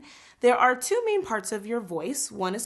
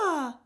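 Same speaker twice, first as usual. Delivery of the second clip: a light, higher "Ah"; pretty head voice ah; 5 semitones higher than usual. Shown as a demonstration. Teacher shows students the head voice again. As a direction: float an easy "Ah". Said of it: "that pretty".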